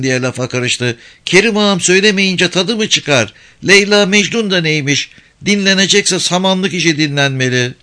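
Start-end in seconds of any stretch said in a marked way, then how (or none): 1.2-7.7 put-on high, lively little village girl; kız çocuğu sesi. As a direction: play a cheeky young girl. Girl insists. (tiz sesli köylü kız)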